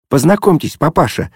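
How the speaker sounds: high, bright joyful chatter; joyful chirping. A narrator's reading. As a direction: chirp it out happily. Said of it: "радостно защебетала Зося". (радостно щебечет)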